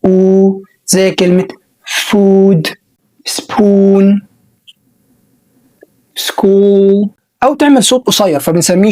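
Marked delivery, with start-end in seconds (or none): 1.9-7.1 "food", "spoon" and "school" drawn out slowly; long oo sound. Shown as a demonstration. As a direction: stretch the long oo. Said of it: "صوت طويل". (صوت أو طويل)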